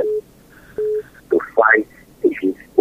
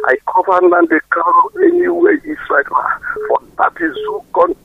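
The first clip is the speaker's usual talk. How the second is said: fervent prayer; 6 semitones higher than usual. Acted. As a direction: pray with full force. Fervent prayer over phone line.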